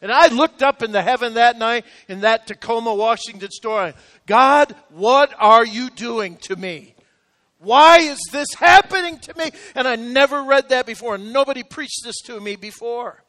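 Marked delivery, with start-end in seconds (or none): 4.3-9.5 anguished cry to God. Retold or imitated. Retold cry to God in store.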